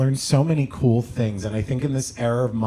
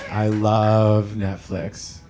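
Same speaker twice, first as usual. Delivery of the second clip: slow gushing; 3 semitones lower, much slower than usual. Shown as a demonstration. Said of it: "set against I never use".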